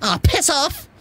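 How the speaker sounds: high, cranky cartoon impression; Greg the Grim Reaper. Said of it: "Greg the Grim Reaper".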